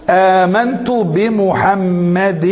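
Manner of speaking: بمحمد drawn out slowly to a final -in; genitive kasra ending. Shown as a demonstration. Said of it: "يعتني علم النحو بضبط آخر الكلمة".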